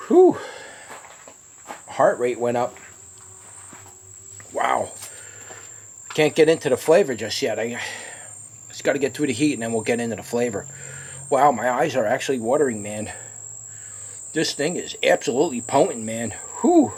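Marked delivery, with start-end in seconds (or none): 0.1-0.4 high whistling blow of breath; sharp exhale. Real, not acted. Gasp after hot pepper bite. 16.6-16.9 high burst of breath out; sharp exhale. Real, not acted. Gasp after hot pepper bite.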